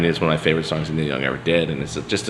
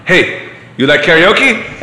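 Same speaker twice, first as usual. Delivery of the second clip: put-on slow, high, loud thick German accent; 12 semitones higher, 9 dB louder, slower than usual. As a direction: imitate the Uber driver's thick German accent, drawn out and loud. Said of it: "like, thick German accent".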